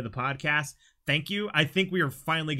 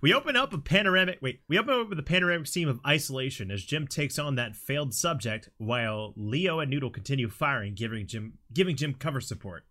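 ordinary talk

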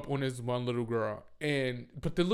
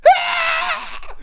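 long, very high, loud scream; imitated scream; 9 dB louder than usual. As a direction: mimic a kid's piercing scream. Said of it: "starts screaming".

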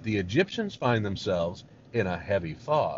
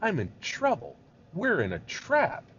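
higher-pitched acted pleading; crying to God; 8 semitones higher than usual. Moses explaining himself to the Lord, acted distressed plea. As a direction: plead as if trapped. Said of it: "why I'm crying to you".

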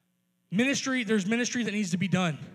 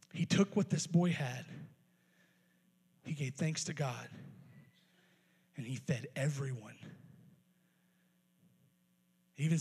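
soft, hushed preaching; 9 semitones lower, 6 dB quieter than usual. Acted. Quiet reflective preaching.

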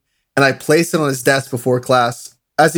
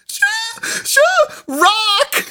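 mock squeaky gasps, very high, breaking into laughter; hysterical cry-laughing; much slower than usual. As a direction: imitate being too hysterical to get words out. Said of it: "could not bring myself to words".

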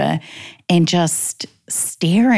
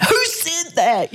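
much higher, slow, alarmed question; alarmed mother; 12 semitones higher, slower than usual. Retold or imitated. Mother asking her son who hurt him, high alarmed voice. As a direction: ask it like a worried mum. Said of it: "And I was like".

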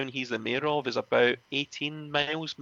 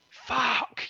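sudden high yelp of frustration; angry outburst; 11 semitones higher than usual. Retold or imitated. Matt losing his temper when nobody oils his back, high angry curse. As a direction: spit it out in fury.

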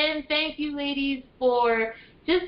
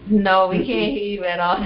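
laughing; 6 semitones lower, 4 dB louder than usual. Real, not acted. Call keeps failing, she laughs.

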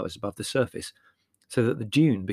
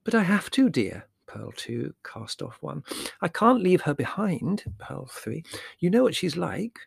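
distracted mother, pitched up; mother arguing while knitting; 7 semitones higher than usual. Mother argues while counting stitches.

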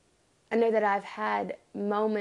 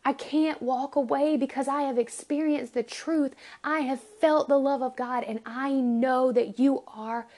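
higher, impassioned declaration after a loud groan; groan, then insist with fervour; anguished conviction; 4 semitones higher, 3 dB louder than usual. Acted. Acts out Jeremiah's torn conviction.

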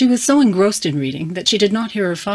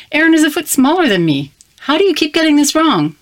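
high, loud mock exasperation; exasperated teasing; 11 semitones higher, 6 dB louder than usual. A narrator's reading. Teen teases her father about mixed-up clothes, high and exasperated. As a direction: tease Dad for getting it wrong again.

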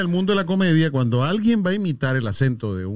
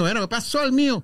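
higher-pitched mock caraqueño street talk; Caracas accent; 7 semitones higher than usual. Host showing the typical caraqueño, mock Caracas accent. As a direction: put on a Caracas thug accent. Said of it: "cuando uno dice caraqueño".

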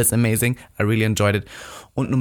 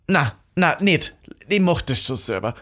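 higher-pitched mock indignation; angry refusal; 5 semitones higher, slower than usual. Bathrobe owner turning down the swap, mildly acted anger. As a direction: imitate a woman huffily refusing help. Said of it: "War so wütend".

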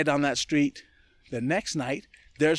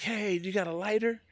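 lightly voiced girl, higher; a girl asking; 8 semitones higher than usual. A street girl flagging down a cyclist, lightly voiced higher. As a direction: flag him down, a touch higher and casual. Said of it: "She's like".

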